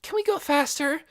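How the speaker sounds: put-on high, urgent girl plea; pleading girl. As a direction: beg to hurry. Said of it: "Nikki asked".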